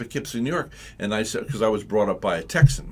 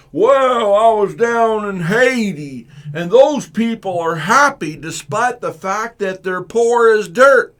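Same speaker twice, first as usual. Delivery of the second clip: loud, high, slow naive gushing; mock gushing visitor; 10 semitones higher, 12 dB louder, slower than usual. People telling the reverend about Haiti, loud mock gushing. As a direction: ham up a gushing tourist back from Haiti. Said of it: "people come up and go".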